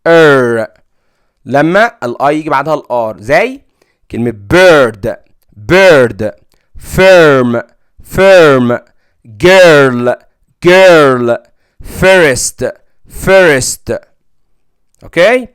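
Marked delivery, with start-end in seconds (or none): 0.1-0.7 ار held as an er vowel; louder teacher demo; er sound. Shown as a demonstration. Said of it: "بنطقها كده الصوت ده ار". 4.1-14.0 put-on bird, firm, girl, first with an er vowel, each said twice; loud, clear repeated models; teacher drilling words twice. Shown as a demonstration. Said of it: "الصوت ده ار".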